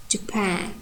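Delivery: "Japan" said softly with stress on second syllable; stress on PAN. Shown as a demonstration. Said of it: "pan is stressed with primary stress".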